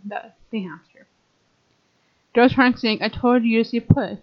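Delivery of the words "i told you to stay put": ordinary delivery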